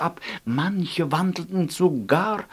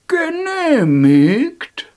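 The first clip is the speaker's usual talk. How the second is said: high, loud, slow, drawn-out granting; grand approval; 10 semitones higher, 7 dB louder, much slower than usual. Acted. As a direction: proclaim it slowly like a god. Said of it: "sagte der gelbe Alte".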